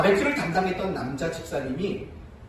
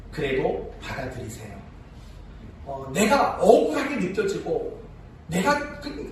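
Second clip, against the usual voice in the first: ordinary talk, nothing marked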